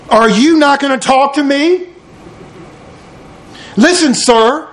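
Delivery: lightly acted official demanding an answer; indignant Pilate. Pilate challenges silent Jesus, mildly indignant.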